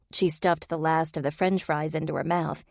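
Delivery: plain reading voice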